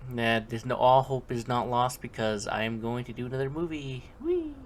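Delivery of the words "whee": playful cheer. Real, not acted. Cheering about doing a movie.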